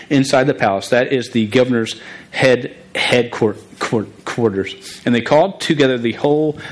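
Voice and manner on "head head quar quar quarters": stuttered repeats in headquarters; real stutter. Real, not acted. Stuttering preacher reading about the governor's palace, stutter. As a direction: stick on each part of the word before finishing it. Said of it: "A st-, stuttering guy".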